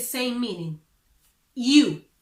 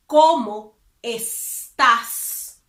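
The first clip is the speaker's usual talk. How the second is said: slow teaching demo; 7 dB louder, much slower than usual. Shown as a demonstration. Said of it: "That's informal".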